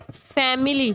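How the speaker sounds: "Family" parted as फैम, milli; split into syllables. Shown as a demonstration. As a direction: break "family" after फैम. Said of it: "हम ऐसे बोलते हैं family". ("Family" को दो हिस्सों में तोड़कर)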